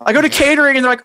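ordinary talk

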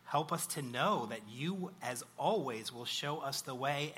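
usual speaking voice

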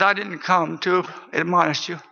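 subdued retold sadness with long pauses; a touch of sadness, sinking at the end; sad voice. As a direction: quote a sorrowful visitor, haltingly. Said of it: "in a real sad voice".

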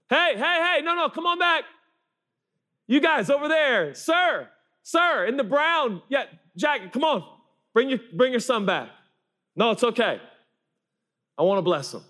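very high, carrying holler; calling out. Acted. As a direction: hail people far off across a crowd. Jesus calling parents back across crowd.

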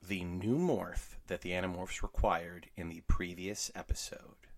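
hushed whispered question; whispering. Acted. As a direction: breathe the question, barely voiced.

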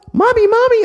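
put-on high, loud child calling Mommy; eager kids calling. Kids begging Mommy for face painting, eager high calls. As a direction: pipe up like an excited little kid.

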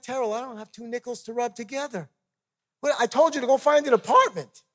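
high-pitched protest, building to loud; retold exasperation. Husband with no money answering his wife, acted exasperation.

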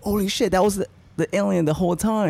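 high, startled realization; mock shock. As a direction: gasp it out like the twist just hit. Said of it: "You're like".

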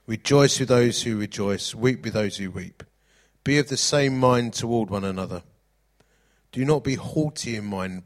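loud, measured Bible reading; proclaiming verses to the congregation; scripture reading. Acted. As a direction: read the verses like a preacher. Reads scripture aloud.

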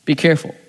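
"Be careful" with the weight on Be; quiet, firm "Be careful" with Be stressed. Shown as a demonstration. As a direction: lean on "Be" and let "careful" fall soft. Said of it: "be careful who or what".

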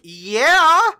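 high, loud, rising "yeah"; incredulous. Acted. Character answers whether he'll face it alone, incredulous rising retort. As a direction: say it as if it were obvious. Acted retort to her question.

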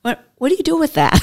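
tossing it off with a bright, amused puzzlement; amused puzzled question. Retold or imitated. Asking a food shelf visitor what the item is for, amused and puzzled. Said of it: "I'll say".

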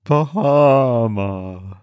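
slow, drawn-out ghostly singing; low haunting voice. Pretend. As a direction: stretch each word like a ghost. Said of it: "do this in a ghost voice".

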